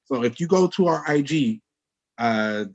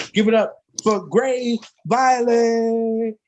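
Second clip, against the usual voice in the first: hype announcer; 8 semitones higher, slower than usual. Real, not acted. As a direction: build it up then crown the champ. Host announces slam winner.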